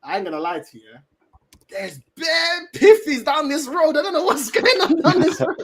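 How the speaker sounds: high, laughing, slowed telling; amused disbelief. Retold or imitated. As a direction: laugh through the words in disbelief. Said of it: "I said to her, I was like".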